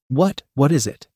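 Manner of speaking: usual speaking voice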